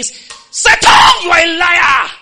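a huge, high roar at Satan; roaring declaration. Shown as a demonstration. Preacher demonstrating a roar against Satan, full-force shout. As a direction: roar it out with total conviction. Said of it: "come out of meditation and say".

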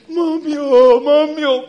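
loud wailing cry, high and strained; crying out for mommy. Retold or imitated. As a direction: wail like a child who hit her leg. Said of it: "You don't say".

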